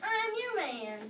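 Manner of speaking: plain reading voice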